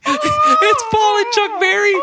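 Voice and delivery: very high, drawn-out mock wail. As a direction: wail it slowly in a hammed-up high screech. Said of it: "[name]'s just like".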